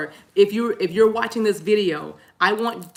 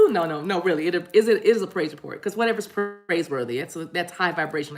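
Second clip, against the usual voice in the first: usual speaking voice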